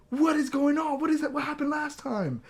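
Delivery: very high, hammed-up shock; acted alarmed disbelief. Patient overhears a family secret on the call, mock-shocked. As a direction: squeal the questions like a scandalized eavesdropper. Acting out own alarmed curiosity.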